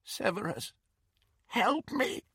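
high, soft, quavering plea; cracked and terrified. Acted. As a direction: beg in terror, voice cracking. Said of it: "in a cracked and terrified voice".

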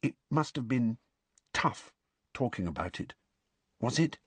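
soft and hushed, nearly a murmur; low voice. A narrator's reading. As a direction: keep it low and careful. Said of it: "went on in a low voice".

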